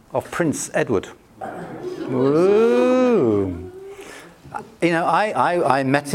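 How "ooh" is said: drawn-out ooh, hammed-up awe; a teasing, starstruck ooh; mock impressed ooh. Mock awe at meeting royalty.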